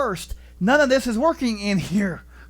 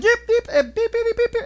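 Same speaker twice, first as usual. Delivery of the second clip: bleep noises; 13 semitones higher, 3 dB louder than usual. Retold or imitated. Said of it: "it was just like".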